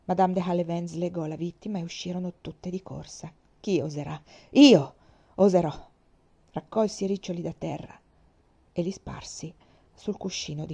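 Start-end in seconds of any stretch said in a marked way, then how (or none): 3.6-5.7 from steady to shouted defiance; building defiance. Acted. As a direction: question held level, then IO bursts out loud. She repeats her defiant motto.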